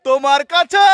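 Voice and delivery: high loud shout to make an echo. Real, not acted. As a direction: call out across the lake. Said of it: "চিৎকার করছে আর ইকো হচ্ছে". (প্রতিধ্বনির জন্য উঁচু গলায় ডাক)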